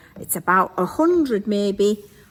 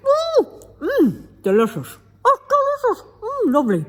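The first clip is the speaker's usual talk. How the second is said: chewing noisily; 11 semitones higher than usual. Real, not acted. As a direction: talk through a mouthful of oatcake. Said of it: "sorry, everybody, I'm eating".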